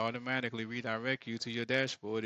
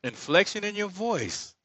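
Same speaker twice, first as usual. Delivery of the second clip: higher, louder showy lilt on "inflection in your voice"; demonstrating inflection; 9 semitones higher, 9 dB louder than usual. Shown as a demonstration. Showing ad makers how lively delivery sounds. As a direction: sing "inflection" up with big expressive swoops. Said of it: "if you have".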